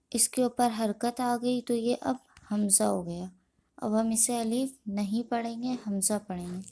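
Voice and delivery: ordinary talk, nothing marked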